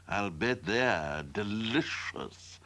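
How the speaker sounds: low, soft, slow savouring; greedy kitten relishing the thought quietly; thinking to himself. A narrator's reading. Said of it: "thought Tom".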